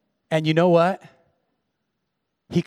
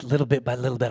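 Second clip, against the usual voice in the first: ordinary talk, nothing marked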